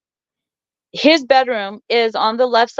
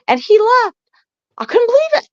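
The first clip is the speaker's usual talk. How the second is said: outraged disbelief; 12 semitones higher than usual. Real, not acted. Outraged disbelief at husband leaving.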